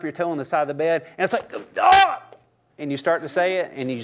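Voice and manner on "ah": sudden high yelp of pain; a sharp cry, cut off before the cuss word. Retold or imitated. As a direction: yelp as if you just stubbed your toe. Stubbing toe on the bed.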